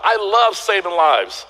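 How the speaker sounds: ordinary talk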